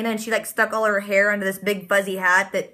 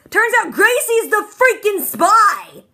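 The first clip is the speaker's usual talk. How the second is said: much higher, louder, slowed exclamation; excited reveal; 10 semitones higher, 6 dB louder, slower than usual. Real, not acted. Vlogger telling viewers Gracie is the spy, a loud excited high burst. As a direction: burst out with the twist.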